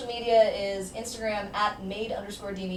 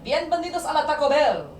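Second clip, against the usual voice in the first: put-on Spanish accent; 6 semitones higher, 4 dB louder than usual. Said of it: "being like".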